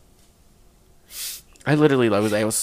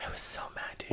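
very soft whispered grumble; hushed aside to a friend; 17 dB quieter than usual. Real, not acted. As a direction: mutter it under someone else's talk. Aside under friend's telling.

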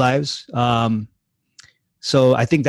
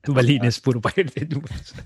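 laughing. Real, not acted. Laughing echo of caller's story.

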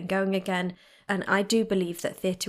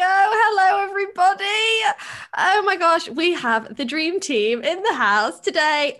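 excited shout; 9 semitones higher, 8 dB louder than usual. Real, not acted. Excited show opener to viewers.

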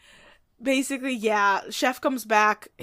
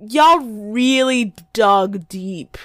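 sarcastic; 8 dB louder, much slower than usual. Real, not acted. Mocking the movie writers.

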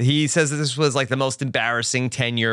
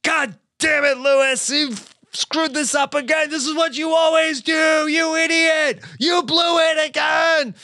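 angry yelling boss; 13 semitones higher than usual. Retold or imitated. Boss yelling at a partner who screwed up, furious shout. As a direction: scream at him like he blew it again. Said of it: "And so he's like".

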